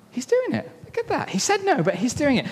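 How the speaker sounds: hammed-up surprise for the kids; playfully astonished storyteller; mock amazement.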